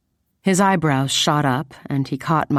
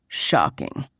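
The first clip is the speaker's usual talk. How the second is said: low, dry deadpan; 5 semitones lower, 3 dB quieter than usual. A narrator's reading. Man reacting to her favorite ice cream, deadpan. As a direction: drop it with no enthusiasm at all. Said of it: "he deadpanned".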